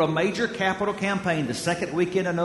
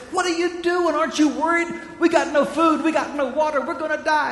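higher, pleading panic, a mock fearful cry; frantic panic; 8 semitones higher, faster than usual. Stranded man pleads with his napping friend, higher frantic panic. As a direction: shake the calm friend awake with mock alarm.

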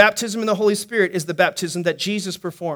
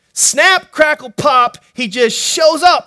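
higher, louder, then slow on "shows up"; excited burst; 4 semitones higher, 4 dB louder, slower than usual. Real, not acted. Preacher exclaiming as Jesus suddenly appears. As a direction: burst out with wonder. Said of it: "Jesus appears".

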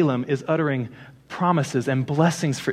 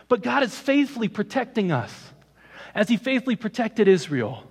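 rising preaching voice; 5 semitones higher than usual. Acted. Preacher urges brothers and sisters, raised preaching. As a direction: lift it higher and press forward, preaching to the congregation.

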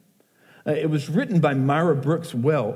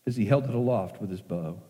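poem recitation. Acted.

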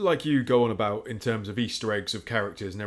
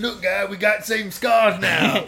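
much higher, louder slow cartoon yell; mock shout; 8 semitones higher, 7 dB louder than usual. A soldier showing his mate their scars, much higher mock shouting. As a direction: show off your scar, yelling.

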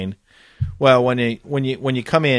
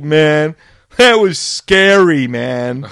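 laughing soldiers; 2 semitones higher, 7 dB louder, much slower than usual. Retold or imitated. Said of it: "they're laughing about it".

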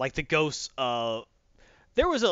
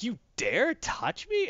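slow, higher, regal outrage; haughty Namor; 10 semitones higher, much slower than usual. Retold or imitated. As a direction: draw it out like an offended sea king. Namor voiced outraged at Ghost Rider.

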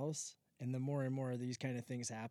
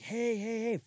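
louder, higher hey calls; calling out; 9 semitones higher, 7 dB louder than usual. Retold or imitated. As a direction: flag someone down from across the site. Workers flagging him down.

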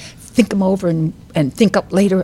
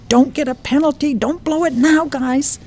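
mock screaming; 8 semitones higher than usual. A narrator's reading. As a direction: read out a restrained, high fan's scream. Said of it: "began screaming at the T V screen".

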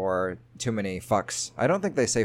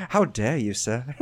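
hammed-up British outrage; huffy posh British accent; mock British indignation. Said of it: "Al loves my British accents".

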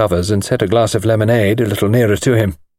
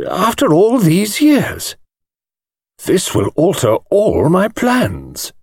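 put-on much higher, slowing, fussily annoyed old man; a precise old gentleman whose plans are spoilt; an old lawyer reading a troubling letter, primly vexed; mild vexation; 10 semitones higher, slower than usual.

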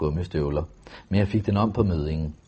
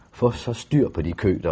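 ordinary delivery

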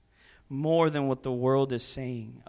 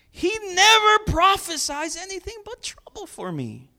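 high, loud petulant complaint; whining king; 6 dB louder than usual. Acted. King complaining to Jehoshaphat, high loud whine. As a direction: whine it like a sulking ruler.

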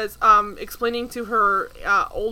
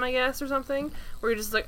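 usual speaking voice

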